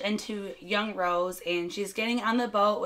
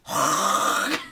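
long throaty hawk, no words; imitated spit hawking; 5 semitones lower than usual. Jack teaching Rose to spit, hammed-up hawking. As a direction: hawk up a big gob for show. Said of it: "Oh, I'll fucking show you".

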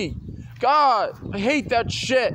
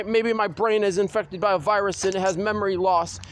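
normal talking voice

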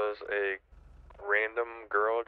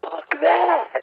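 outraged shout; 12 dB louder than usual. Real, not acted. Outburst at a 28-hour shift.

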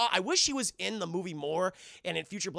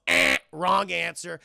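loud, slow game-show rejection; mock buzzer dismissal; 8 dB louder, slower than usual. Killmonger correcting the museum exhibitor, loud mock buzzer. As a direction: buzz them out like a wrong quiz answer.

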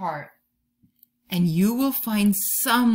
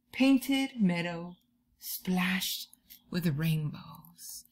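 poem reading; 2 semitones lower, 4 dB quieter, much slower than usual. Acted.

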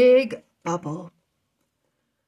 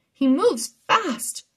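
high, loud warning call; 8 semitones higher, 5 dB louder, slower than usual. Acted. Anna warning the boys from above, loud and high. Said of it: "Anna called down from Capri".

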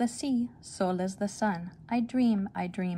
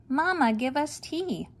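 put-on kids yelling; 7 semitones higher, 3 dB louder than usual. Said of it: "Both of them are yelling".

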